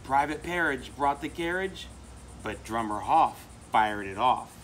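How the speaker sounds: plain reading voice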